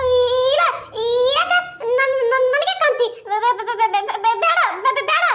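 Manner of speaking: high, pleading village woman voice, close to tears; frightened woman. Acted. A scared woman begging the ghost to leave her, high tearful plea.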